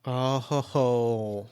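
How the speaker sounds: low, slow, soft knowing chuckle-word; scandalized oh ho ho. Real, not acted. Host hears of a secret affair, slow and knowing. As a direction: savor the juicy gossip. Reacting to a gossipy affair reveal.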